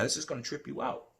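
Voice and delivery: usual speaking voice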